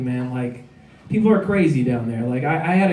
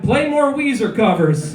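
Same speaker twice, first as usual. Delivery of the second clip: retold heckle shout; 7 semitones higher than usual. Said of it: "someone else shouted".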